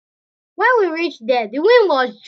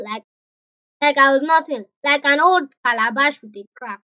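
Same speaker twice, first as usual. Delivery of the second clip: ordinary delivery, nothing marked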